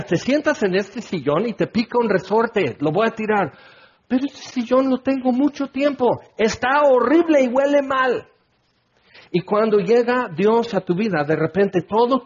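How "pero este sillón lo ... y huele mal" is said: high-pitched acted squabble; arguing over junk. Preacher acting out a dispute over throwing out an armchair, arguing. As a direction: argue it out with raised pitch.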